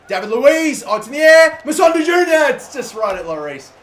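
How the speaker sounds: excited shout. Real, not acted. Gamer hoping for a late winner from a corner, excited shout. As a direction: shout as the ball hangs in the air.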